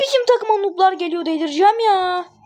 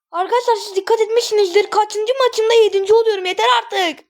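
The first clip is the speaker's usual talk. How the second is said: ordinary talk, nothing marked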